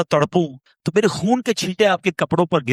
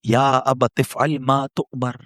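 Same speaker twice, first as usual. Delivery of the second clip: recitation; 5 semitones lower, slower than usual. Acted. Quranic verse recited slowly.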